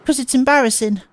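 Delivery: put-on high, bashful girl confessing; shy cutesy girl. Transfer student explaining her silence, high shy cute voice. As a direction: admit it sheepishly.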